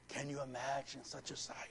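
hushed, soft wonder; awed whisper. Real, not acted. Preacher marvels at the rapture to the church, awed whisper.

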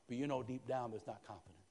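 ordinary talk, nothing marked

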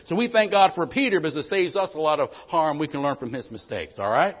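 fired-up sermon; preaching build. Acted. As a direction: lift it up and drive it home. Preaching rises again.

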